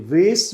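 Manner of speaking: वेस with ay and s, "vace"; American pronunciation. Shown as a demonstration. Teacher mentions the other form, American pronunciation. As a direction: say वेस to rhyme with face. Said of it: "American pronunciation में इसे".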